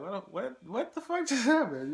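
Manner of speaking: stunned disbelief. Retold or imitated. Watching the kid nearly cry, high laughing shock. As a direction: squeal it, baffled and laughing. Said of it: "I'm just looking like".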